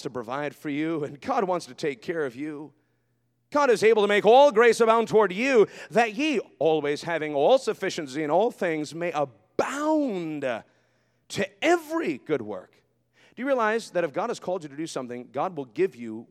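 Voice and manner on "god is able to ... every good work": loud, then slow, drawn-out reading; scripture proclamation. Acted. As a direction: declaim the verse, slowing at the end.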